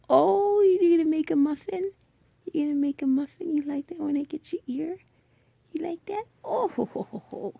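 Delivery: excited pet talk. Real, not acted. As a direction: tease him happily while scratching his ear.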